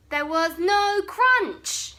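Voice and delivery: ordinary delivery, nothing marked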